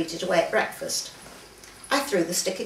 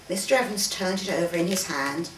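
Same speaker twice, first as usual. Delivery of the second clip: usual speaking voice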